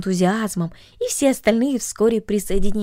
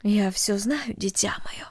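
soft, hushed and gentle; mother hushing her child; quiet; 6 dB quieter, slower than usual. Acted. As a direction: softly comfort the frightened child. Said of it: "тихо сказала мать".

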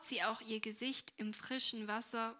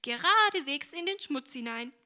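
plain reading voice

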